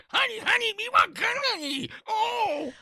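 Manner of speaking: high bouncy cereal-ad jingle voice; cereal mascot. Acted. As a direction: chant it like a breakfast TV spot. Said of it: "it's like a cereal commercial".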